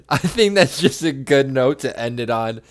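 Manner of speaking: laughing out loud as he wraps up; can barely get the words out for laughing. Real, not acted. Cohost cracking up while ending the rant, laughing.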